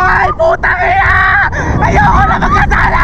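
high, loud panicked yelling; terrified shouting. Real, not acted. Screaming on a thrill ride.

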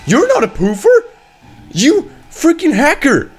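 high, loud outburst; outraged accusation. Real, not acted. Gamer accusing the enemy of cheating, outraged shout. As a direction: shout it in squawking disbelief.

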